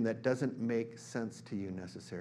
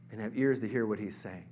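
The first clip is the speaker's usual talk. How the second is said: ordinary talk, nothing marked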